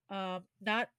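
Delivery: fighting off a sneeze; talking through a held-in sneeze; holding back a sneeze. Real, not acted. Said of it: "I'm trying not to sneeze".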